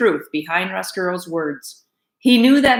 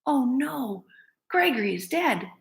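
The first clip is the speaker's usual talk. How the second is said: soft, stunned murmur; hushed dismay; 5 semitones higher, 7 dB quieter than usual. Acted. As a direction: barely voice it. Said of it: "whispered Despero".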